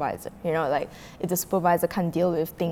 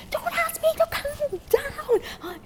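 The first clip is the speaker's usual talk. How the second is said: very high, drawn-out imitation of outrage; mock yell; slower than usual. Customer told to calm down, mock yelling. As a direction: squeal it indignantly without real volume. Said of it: "he kept yelling and yelling".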